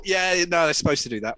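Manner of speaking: loud offhand dismissal; mock helpline. Helpline operator dismissing stuck caller, loud and offhand. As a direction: brush off the caller.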